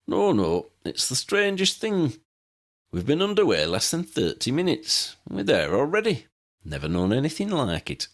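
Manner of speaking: lightly voiced eccentric old man, fond and wry; old pilot Pelicus. Old pilot showing guests his plane, lightly voiced old man.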